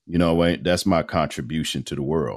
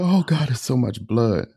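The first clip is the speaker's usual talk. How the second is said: fighting back tears; 7 semitones higher than usual. Retold or imitated. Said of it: "fighting back tears".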